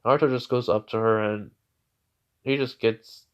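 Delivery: ordinary delivery, nothing marked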